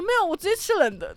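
lightly voiced grandma; imitating grandma. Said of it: "然后我奶奶说". (略微提高声调学奶奶)

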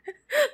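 sudden squeaky laugh burst; high-pitched giggle. Real, not acted.

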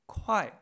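Kuai loud, from high to a quick fall; cuarto tono. Shown as a demonstration. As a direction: drop sharply through Kuai. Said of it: "cuarto tono". (Kuai fuerte, alto y descendente)

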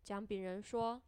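ordinary delivery, nothing marked

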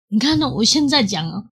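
ordinary talk

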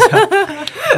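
a squeaky burst of laughter; high giggle. Real, not acted. Laughs at own joke.

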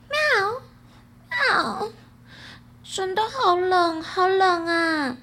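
high, drawn-out cat's meow and lament; mewing cat voice. A narrator's reading. A cat calling from a dark corner, mewing voice. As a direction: draw the words out slowly like a mewling cat. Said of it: "在一个角落传来了一个叫声".

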